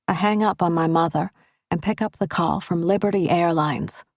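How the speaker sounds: plain reading voice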